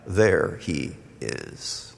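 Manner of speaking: ordinary talk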